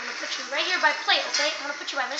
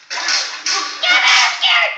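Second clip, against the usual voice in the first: much higher, louder squeaky panic; panicked candlestick; 9 semitones higher, 12 dB louder, much slower than usual. Acted. Candlestick panics at the dragons, high and loud. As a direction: shriek it like a terrified tiny sidekick.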